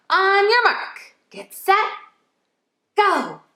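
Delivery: on your mark countdown, high and eager; excited countdown. Acted. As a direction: rev them up for the race. Starting a letter hunt race.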